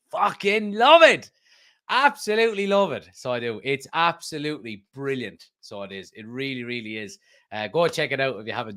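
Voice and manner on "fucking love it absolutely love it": thrilled fan, loud and high; excited rave. Real, not acted. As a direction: gush with real fan excitement. Raving about Roy Keane video.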